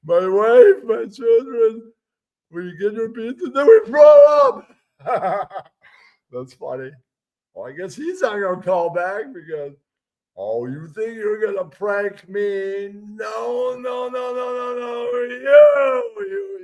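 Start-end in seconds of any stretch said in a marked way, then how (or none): 0.0-4.7 high, building to a shouted finish; hammed-up pizza guy rising to a yell; terrorist pizza bit. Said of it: "I love terrorist pizza". 10.4-16.6 high, slow singsong taunt; mocking taunt. Taunting the prank caller.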